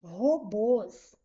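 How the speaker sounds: "Robôs" split into ro-BÔS, weight on BÔS; stress on final syllable. Shown as a demonstration. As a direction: say "Robôs" in two separate syllables, leaning on the last. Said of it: "A última sílaba é mais forte". ("Robôs" separado, com força no BÔS)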